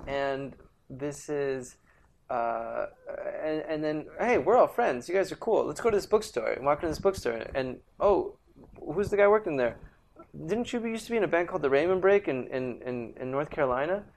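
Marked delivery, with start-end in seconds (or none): 4.2-6.5 excited retold chatter. Retold friends introducing themselves. 8.0-14.0 excited retold question. Retold asking bookstore clerk.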